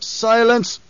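high, loud furious roar. A narrator's reading. Emperor cutting off the Baron with a furious roar. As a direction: bellow him into silence. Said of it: "the Emperor roared".

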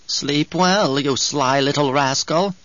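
slow, wheezy crone voice; sly old crone. Acted. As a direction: coo it like a sly old witch. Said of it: "said the old woman".

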